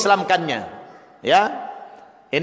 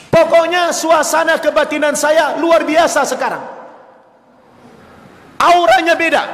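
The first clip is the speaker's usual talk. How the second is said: high-pitched, emphatic retelling; overwhelmed; 8 semitones higher, 4 dB louder than usual. Retold or imitated. Father tells the ustadz the aura differs, overwhelmed. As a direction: push it up, intense and stirred.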